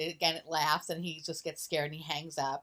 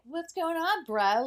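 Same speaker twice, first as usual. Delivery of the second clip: casual bro voice; 10 semitones higher, 4 dB louder, slower than usual. Retold or imitated. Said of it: "Ouija's like".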